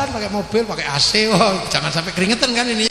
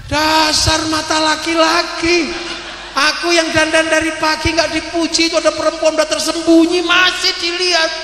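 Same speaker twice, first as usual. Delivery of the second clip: jealous wife scolding; 7 semitones higher than usual. Retold or imitated.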